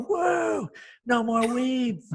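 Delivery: very high, slow, stretched amazement; amazed commercial voice. Retold or imitated. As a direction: marvel like an infomercial actor. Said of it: "I'm just all".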